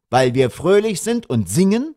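affronted, rising retort; indignant. A narrator's reading. Father challenging the traffic policeman, indignant.

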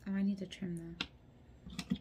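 ordinary talk

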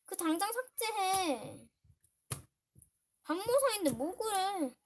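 high, loud, drawn-out complaining; real upset scolding. Real, not acted. Girl demanding her sister delete a comment, loud upset scolding. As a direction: protest loudly, stretching the ends of phrases.

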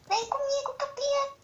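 high-pitched quoted game line; quoting a character line. Retold or imitated. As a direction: raise the voice way up to mimic a character. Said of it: "Roubei a fala do João".

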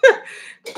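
short unvoiced chuckle; amused laugh. Real, not acted. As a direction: laugh it off at yourself. Laughs at being corrected.

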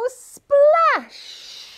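high, loud, long-hissed finish; exclaimed splash. Pretend. Tipping into the water at the rhyme's end, high shout. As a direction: burst out with the splash.

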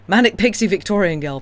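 normal talking voice

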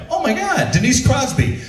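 high, starstruck gasp. Retold or imitated. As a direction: gush at spotting a celebrity. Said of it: "and I'm like".

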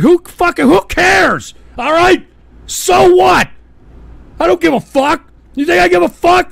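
loud, high yelling defiance; defiant shouting. Real, not acted. Streamer yelling at critics who mock his gifts, loud defiance. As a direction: yell that you don't care at all.

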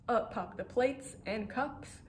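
ordinary delivery

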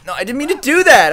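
high, loud whine of dismay; dismayed protest. Real, not acted. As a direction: protest your own blunder in a high voice. Game mistake.

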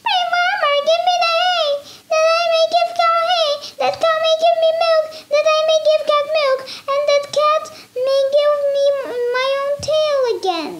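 high, squeaky pleading mouse; a tiny mouse begging, speeding up; little mouse voice. Acted.

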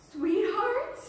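relieved high call of the name. A narrator's reading. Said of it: "Kate sounded so relieved".

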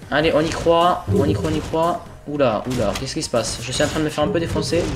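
ordinary talk

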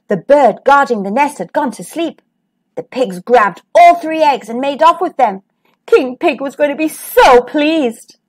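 ordinary delivery

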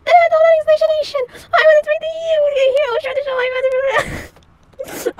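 high, panicky half-crying plea; panicked. Acted. Caught in socks, pleading in panic. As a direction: scramble to explain, near tears.

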